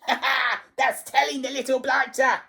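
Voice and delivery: high, fast cackling screech; gloating, high-pitched jeer; shrieking old harridan. A narrator's reading. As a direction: crow it with spiteful glee. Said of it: "shrieked Missus Pratchett".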